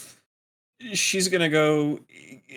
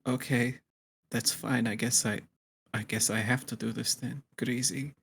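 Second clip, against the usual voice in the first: soft, low, resigned put-down; cool insult; 3 semitones lower, 8 dB quieter than usual. Acted. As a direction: insult him quietly, without raising your voice. Hungover character coolly insults rival.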